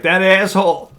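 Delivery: lightly acted outrage; imitating pissed-off biker. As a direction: curse like an annoyed biker. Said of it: "Dave's all pissed".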